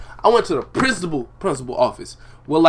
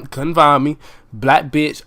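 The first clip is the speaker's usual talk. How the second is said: normal talking voice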